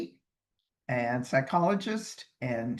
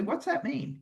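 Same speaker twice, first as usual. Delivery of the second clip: mock puzzled question; 11 semitones higher than usual. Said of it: "We'll say".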